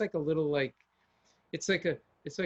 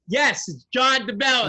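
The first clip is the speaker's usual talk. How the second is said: loud, slow, proud announcement of his own name; mock loud reply; 8 semitones higher, 10 dB louder, slower than usual. Celebrity answering the whisperers, loud and slow. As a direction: boom your own name right back at them.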